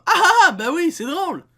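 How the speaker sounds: mock giggle, high and loud; fake-laugh along like a good kid; forced polite laugh. Mocking a good kid faking a laugh for the French. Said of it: "on va faire comme".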